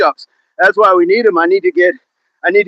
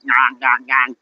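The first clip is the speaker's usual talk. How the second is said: low, soft nasal chomping sound; imitated chewing noise; 5 semitones lower, 5 dB quieter, slower than usual. Shown as a demonstration. Showing viewers the jaw exerciser, imitated chewing noise. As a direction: mimic chewing on a jaw ball. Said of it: "that ball where you're supposed to".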